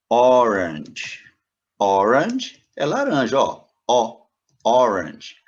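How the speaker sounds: "orange" loud, opening on a pure O; model pronunciation. Shown as a demonstration. Teacher models "orange" loudly for students, pure O. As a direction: open "orange" on the vowel, no glide. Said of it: "Não tive nenhum som antes".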